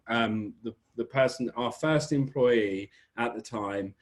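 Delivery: ordinary delivery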